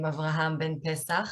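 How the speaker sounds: usual speaking voice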